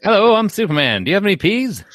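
loud, high showman's pitch; carnival barker. Pretend. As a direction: bellow it like a sideshow barker. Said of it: "I'm talking like a carnival barker".

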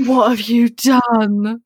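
slow, drawn-out horrified question; whole family aghast at the child. Retold or imitated. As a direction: stretch it out in shock. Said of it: "they were like".

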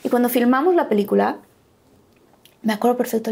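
normal talking voice

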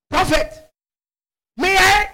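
lightly voiced woman, high-pitched; woman addressing prophet.

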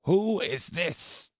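high, slow, imperious demand; severe. A narrator's reading. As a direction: severe queen demanding an answer. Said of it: "the queen said severely".

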